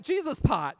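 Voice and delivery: "Jesus pot" called out high and loud; excited exclamation. Retold or imitated. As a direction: shout "Jesus pot" with a rush of joy. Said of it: "So I literally, I was like".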